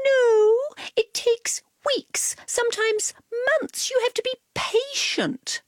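prissy cat explaining; Cat's voice. A narrator's reading.

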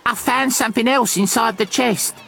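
put-on high-pitched, urgent girl pirate; crying out. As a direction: call the news out in a high voice. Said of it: "she cried".

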